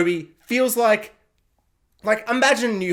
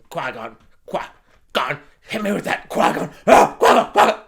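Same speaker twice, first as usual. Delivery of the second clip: mock craving nerd; 5 semitones lower, 4 dB louder than usual. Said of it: "And the nerds are just like".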